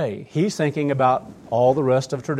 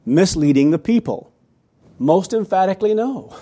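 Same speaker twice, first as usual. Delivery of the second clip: loud question, then higher firm denial; emphatic reading aloud; 5 semitones higher, 6 dB louder than usual. Acted. Preacher reads a leader's denial of misleading, emphatic. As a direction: read out the denial with force.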